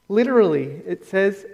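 ordinary talk

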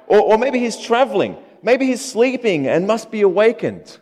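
mock them with feigned concern; patronizing mockery. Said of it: "Sort of patronizing this".

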